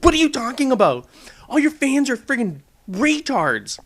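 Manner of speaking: mock the baffled commenter; baffled troll. Vlogger mimicking a confused commenter, lightly baffled. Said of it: "Make them go".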